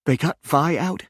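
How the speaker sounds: high, close to tears; a scared question through tears; tearful. A narrator's reading. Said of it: "Ronnie was crying".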